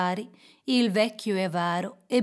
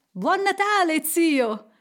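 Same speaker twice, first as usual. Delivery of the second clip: high, bright-spirited holiday greeting called out; cheerful cry; 7 semitones higher, 4 dB louder, slower than usual. A narrator's reading. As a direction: call out a merry greeting. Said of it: "gridò una voce allegra". (grido allegro)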